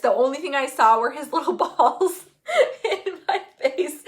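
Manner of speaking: delighted laugh bubbling into the words; laughing through emotion. Real, not acted. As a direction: tell it through a tearful laugh. Tearful laughing memory of birth.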